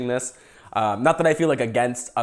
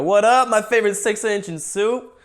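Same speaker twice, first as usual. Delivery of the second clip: higher hammed-up buddy greeting; homies' greeting; 8 semitones higher, 4 dB louder than usual. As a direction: greet him like one of the homies. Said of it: "They go".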